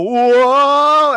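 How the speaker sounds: high, loud startled cry; falling cry. Retold or imitated. Players slipping down the stairs, high loud startled cry. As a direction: yelp as your feet slip.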